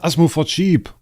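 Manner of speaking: high, loud disbelieving repeat of Asmo; incredulous. Real, not acted. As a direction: throw the question back in disbelief. Incredulous repeat of chat message.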